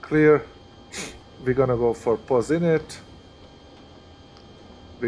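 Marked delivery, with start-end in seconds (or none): none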